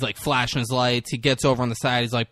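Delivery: ordinary talk, nothing marked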